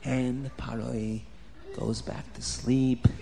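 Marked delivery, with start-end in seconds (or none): none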